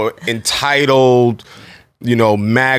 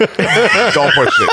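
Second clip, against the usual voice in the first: laughing; 8 semitones higher, much slower than usual. Acted. Jokingly warning co-host.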